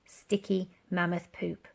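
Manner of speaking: plain reading voice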